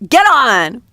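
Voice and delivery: sudden hearty shout; excited command. Acted. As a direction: call out an eager order. Acted excited command to board broom.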